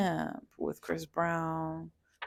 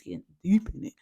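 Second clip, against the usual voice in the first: whisper; 5 dB louder, faster than usual. Real, not acted. Whispered aside.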